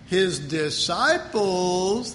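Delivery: his disciples drawn out very slowly; emphasis on disciples. Shown as a demonstration. Pastor reading scripture to the congregation, slow emphasis. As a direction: lean on disciples, slow and deliberate. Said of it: "there's the first emphasis".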